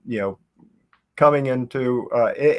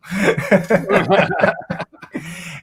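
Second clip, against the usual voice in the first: laughing; 6 semitones higher, 3 dB louder than usual. Real, not acted. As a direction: burst of laughter. Laughing after the quote.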